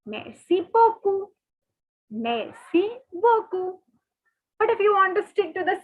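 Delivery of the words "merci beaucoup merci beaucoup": merci beaucoup slow, stress on coup; French merci beaucoup. Shown as a demonstration. Teacher models thank you very much slowly. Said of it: "the word for that is".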